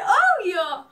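loud, high, emphatic agreement; eager yes. Retold or imitated. Dreamer gives idol her number, eager shout. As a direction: blurt it out at full volume.

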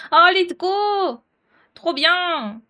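slow, high, loud cheer; drawn-out happy cheer; excited. Real, not acted. Delighted reaction to Skype working.

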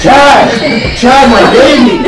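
very high, very loud frantic cry; father shouting at the roommate holding his baby; panicked shout. Acted. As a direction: shriek his name in alarm. Panicked about his crying baby.

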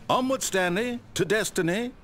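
high, grand call to adventure; rally him onward with a flourish; rousing call. Acted. Narrator urging Stanley onward to destiny, rousing.

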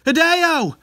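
loud high cry for a friend; anguished call. Acted. Yuji calls to his attacked friend, anguished. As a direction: call out in horror.

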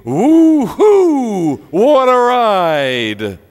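high, loud, drawn-out whoop; exuberant scream. Acted. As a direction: holler it like the end of a wild ride. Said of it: "and screaming".

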